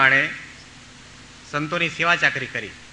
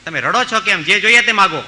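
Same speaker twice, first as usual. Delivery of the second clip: raised, generous call; king's loud offer; 4 semitones higher, 6 dB louder than usual. Retold or imitated. King on horseback calling to the crying old woman, loudly. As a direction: boom out the royal offer.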